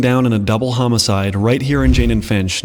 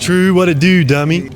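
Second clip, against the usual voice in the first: loud, higher Baltimore accent impression; 6 semitones higher, 5 dB louder than usual. Host showing locals how Baltimore talks, exaggerated Baltimore accent. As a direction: put on a punchy Baltimore accent. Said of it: "Baltimore has a fucked up accent".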